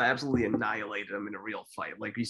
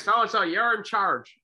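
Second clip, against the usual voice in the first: mock referee order; 8 semitones higher, 5 dB louder, slower than usual. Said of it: "He was like".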